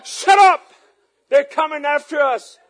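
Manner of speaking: high, loud acted alarm; alarmed yell, played for laughs; panicked shout. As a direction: shout her down in a mock panic. Acts out panicked hushing again.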